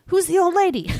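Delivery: high, loud excited yell. Retold or imitated. Friend retelling a movie scene, loud excited yell. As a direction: blurt it out like a starstruck fan. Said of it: "He, like, yells it".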